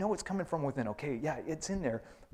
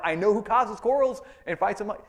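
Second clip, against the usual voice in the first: higher, louder accusing complaint; griping churchgoer; 6 semitones higher, 7 dB louder than usual. Acted. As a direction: point the finger out there.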